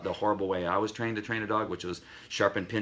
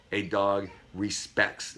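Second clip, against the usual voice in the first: ordinary talk, nothing marked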